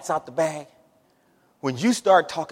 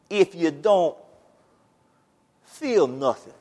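quiet, halting Louisiana drawl; slow Louisiana delivery with long pauses between phrases; Louisiana accent; 3 dB quieter, slower than usual. Acted. Preacher quoting a visiting doctor on saved folks, Louisiana accent. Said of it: "in my best Louisiana accent".

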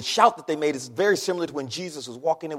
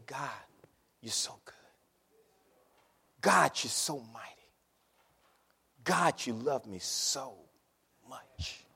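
soft, much quieter prayer, slow and reverent; hushed prayer; 5 semitones lower, 7 dB quieter than usual. Acted. Preacher models praising God before anything, hushed prayer. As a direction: pray it close and low, almost breathing it.

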